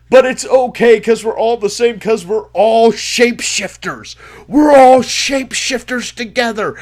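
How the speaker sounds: loud and high, yelling into the mic; yelled rant. Retold or imitated. Convention crank yelling to the crowd, loud and high. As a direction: bellow it like a man who has lost it. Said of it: "And then he starts yelling".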